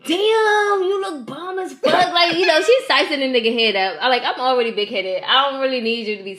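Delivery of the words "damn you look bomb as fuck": higher, slow admiring put-on voice; drawn-out mock compliment; imitating a flirt. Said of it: "She's like".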